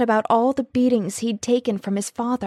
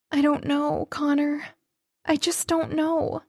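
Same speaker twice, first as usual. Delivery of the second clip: crying; 7 semitones higher than usual. A narrator's reading. She cries to Connor in his arms, higher and tearful. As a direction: say it through tears, lost. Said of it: "I started to cry".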